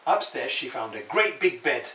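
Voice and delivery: ordinary delivery, nothing marked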